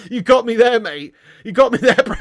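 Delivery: ordinary talk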